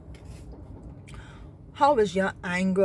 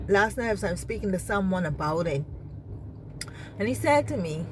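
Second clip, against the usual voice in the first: normal talking voice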